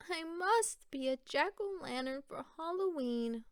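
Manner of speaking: a touch of wistful resolve; lightly voiced hopeful pumpkin. Littlest pumpkin to herself, lightly voiced and longing. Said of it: "thought the littlest pumpkin".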